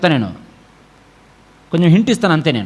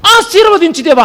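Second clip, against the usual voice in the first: mock pleading prayer; 10 semitones higher, 5 dB louder than usual. Mocking a believer's shallow prayer.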